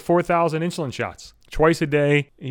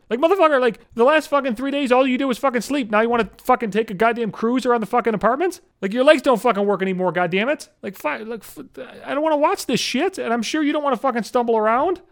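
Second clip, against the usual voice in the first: desperate rant; 8 semitones higher than usual. Real, not acted. Ranting at the cat directly.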